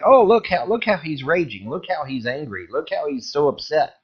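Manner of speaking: high, gloating mock voice; mocking troll voice. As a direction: sneer it like a smug tattletale. Mocking trolls showing rage video.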